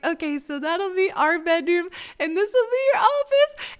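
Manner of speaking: rising mock-tearful voice; about to cry. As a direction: start composed, climb high toward tears. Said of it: "then I just started ugly crying".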